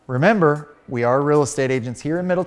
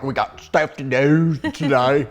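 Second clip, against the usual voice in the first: imitating Bethany; 4 dB louder, slower than usual. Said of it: "you're like".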